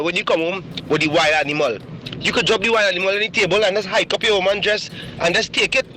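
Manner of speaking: usual speaking voice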